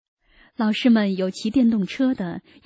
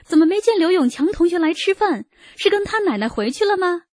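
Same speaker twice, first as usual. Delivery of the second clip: high-pitched, mildly raised narrated call; 9 semitones higher, 3 dB louder than usual. A narrator's reading. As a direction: call out across a dining hall, much higher. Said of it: "饭厅负责的老师高声叫道". (高声喊问)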